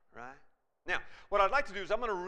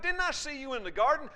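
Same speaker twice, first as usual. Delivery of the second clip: high, raised-voice accusation; retold accusing shout; 8 semitones higher, 6 dB louder than usual. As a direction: challenge him loudly, voice pitched up. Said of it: "And he shouted".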